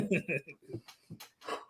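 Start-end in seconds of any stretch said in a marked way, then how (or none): 0.0-0.1 laughing through the words; cracking up while saying it. Real, not acted. Laughing at own joke.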